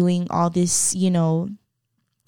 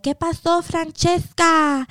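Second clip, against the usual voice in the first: high, drawn-out call across the yard; sing-song, high-pitched inquiry; nosy neighbor; 8 semitones higher, slower than usual. Retold or imitated. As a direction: imitate a neighbor calling over in Spanish. Nosy neighbours calling over in Spanish.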